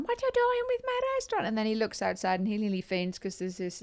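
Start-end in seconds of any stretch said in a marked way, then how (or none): 0.0-1.5 much higher, fast indignant voice; outraged waiter. Retold or imitated. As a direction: squeal it like the shocked restaurant waiter. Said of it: "the Chinese waiter's going".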